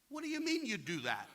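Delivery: retold disbelief. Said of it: "I'm like".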